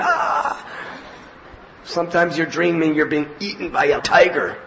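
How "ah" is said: high mock scream of terror; a sudden high yelp of fright; dream scream. Dreamer falling off a mountain, mock scream. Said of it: "even in your sleep you're going".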